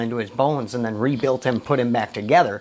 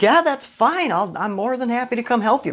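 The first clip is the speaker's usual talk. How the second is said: eager reply; 10 semitones higher than usual. Retold or imitated. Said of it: "And I was like".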